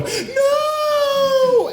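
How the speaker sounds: very high, drawn-out falsetto cry; Sarah Connor. Retold or imitated. Podcast host imitating heroine seeing a Terminator, high falsetto. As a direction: imitate a scream in thin falsetto. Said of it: "her voice goes into this really high falsetto".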